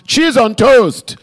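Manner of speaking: loud, slow Nigerian dad demanding; shouted Nigerian accent. Retold or imitated. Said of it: "I'm just sort of shouting out an accent".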